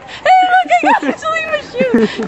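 squeaky high voice, laughing; hammed-up gloating friend; mock high voice. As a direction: tease in a silly high voice. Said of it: "Liz is like".

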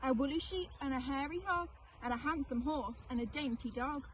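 usual speaking voice

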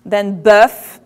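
bœuf said the French way, rounded vowel. Shown as a demonstration. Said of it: "their pronunciation is interesting".

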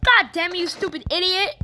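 high, loud furious yell; angry outburst. Real, not acted. Gamer raging at teammate's mistake, loud furious yell. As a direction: blow up at them.

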